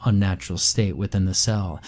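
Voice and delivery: ordinary delivery, nothing marked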